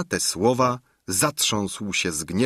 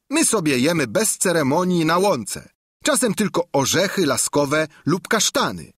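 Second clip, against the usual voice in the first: proud, higher squire; Sancho's voice; 7 semitones higher than usual. A narrator's reading. Sancho defends his master to the innkeeper, proud. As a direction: stand up for your master.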